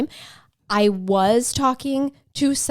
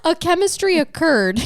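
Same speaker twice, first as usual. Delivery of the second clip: higher, hammed-up Southern twang; mock Southern accent; 9 semitones higher, 4 dB louder than usual. Joking with co-host about the husband's attraction, hammed-up Southern accent. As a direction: butcher a Southern accent playfully. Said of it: "We're gonna butcher your accents now".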